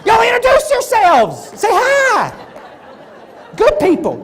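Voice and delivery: bluffing host calling out to the room; loud call-out. Acted. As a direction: call them over to each other. Calling people over.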